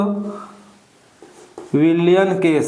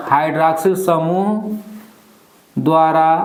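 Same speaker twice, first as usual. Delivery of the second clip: word-by-word dictation with long pauses. Real, not acted. Dictating the heading for writing.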